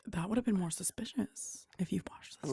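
hushed, conspiratorial whisper; whispered reply. Real, not acted. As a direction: whisper back softly. Whispering back about the secret.

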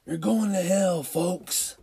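high, loud retold holler; mock yell. Protester to couple outside the clinic, yelling. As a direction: holler it like a sidewalk heckler. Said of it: "he yells and goes".

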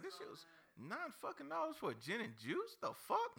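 high, incredulous retold complaint; indignant squeal at a nine-dollar drink; outraged. Retold outrage at bar prices.

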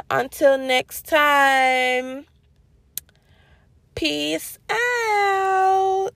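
slow, sing-song farewell, climbing high; big lilting goodbye, last word held; drawn-out sign-off. Real, not acted. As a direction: stretch each word out long and playful.

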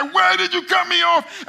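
high, loud road-rage yell; angry yelling. Acted. Angry driver yelling at the guy who cut him off, furious. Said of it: "he started yelling at the guy".

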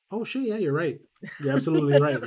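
high, loud mock dawning realization; sudden realization. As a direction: imitate a clerk suddenly catching on. Clerk suddenly realizes his mistake.

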